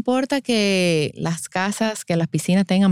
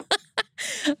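soft, high giggle; amused laugh; 12 semitones higher, 7 dB quieter than usual. Real, not acted. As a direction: let real giggles trail on softly. Amused by son's claim about camp.

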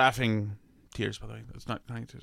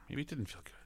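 whisper; 17 dB quieter, faster than usual. Real, not acted. Muttered aside to himself.

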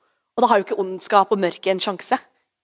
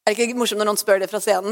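ordinary talk, nothing marked

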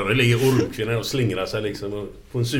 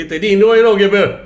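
gloating taunt; 9 semitones higher, 8 dB louder than usual. Acted. Man on the bridge taunting boy.

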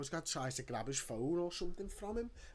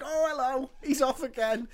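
exasperated; 12 semitones higher, 9 dB louder than usual. Retold or imitated. Exasperated at guard's outburst.

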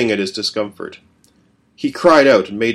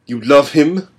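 higher, louder sneering query; louder cruel father; mocking question; 5 semitones higher, 6 dB louder, slower than usual. A narrator's reading. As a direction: taunt him with it.

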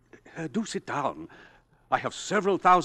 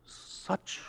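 a stunned, breathless question barely above a whisper; awed disbelief; 5 semitones higher, 6 dB quieter, much slower than usual. Acted. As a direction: let the shock take your voice away as you ask. Professor stunned by the threat.

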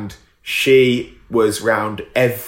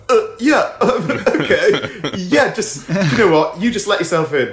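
mock flustered agreement, higher, slow start; awkward polite British yes; 5 semitones higher, slower than usual. Neighbour walks in, mock awkward British politeness. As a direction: stammer out a reluctant polite yes. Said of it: "British people will just go".

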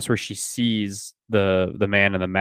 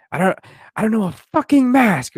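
mock exasperated outburst, pitched way up; breaking point; 12 semitones higher, 4 dB louder than usual. Frightened woman answers her doubting boyfriend's questions, mock exasperated outburst. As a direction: imitate someone snapping, voice jumping high. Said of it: "that's where she had her breaking point".

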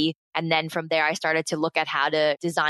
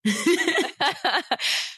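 high laugh at herself; 11 semitones higher than usual. Real, not acted. Embarrassed at her own accent, laughing high. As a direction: giggle high and bright at her failed accent.

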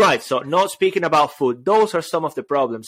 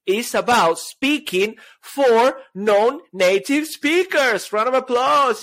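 mock hype; 9 semitones higher, much slower than usual. Host announcing the topic to listeners, hyped-up cheer. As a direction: whip up the crowd like a game show host.

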